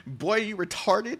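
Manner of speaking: high, rising taunt; hammed-up mocking question; mock Mikazuki. Friend Mikazuki mocks the streamer's pronunciation with a high taunt. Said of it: "Mikazuki is gonna come up and be like".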